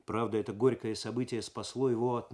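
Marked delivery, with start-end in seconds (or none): none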